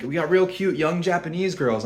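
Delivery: mock sales pitch in a high put-on voice; pushy tout. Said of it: "He's like".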